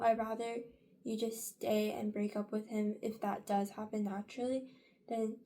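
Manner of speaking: usual speaking voice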